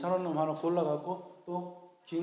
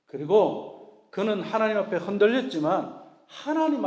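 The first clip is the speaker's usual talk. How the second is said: emphatic preaching; 5 semitones higher, 9 dB louder, slower than usual. Real, not acted.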